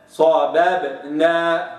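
صبابنا slow, first ب with fatha; slow careful صبابنا; clear baas. Shown as a demonstration. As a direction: say صبابنا without bouncing the moving ب. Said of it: "هكذا".

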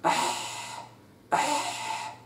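أح breathed out without voice; whispered ah. Shown as a demonstration. Teacher showing ح from the upper throat, whispered أح. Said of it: "وتعال قول".

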